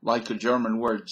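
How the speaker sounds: usual speaking voice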